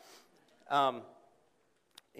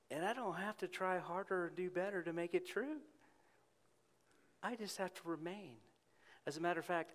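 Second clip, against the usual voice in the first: quiet reflective preaching; 6 semitones higher, 7 dB quieter than usual. Acted.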